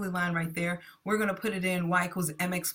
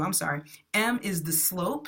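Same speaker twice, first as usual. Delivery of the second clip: usual speaking voice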